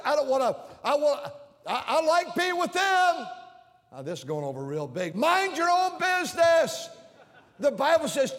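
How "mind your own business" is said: higher, louder, slower command; a preacher's shout, slow and high. Real, not acted. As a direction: drive the words home with a shout. Preacher shouts key phrase.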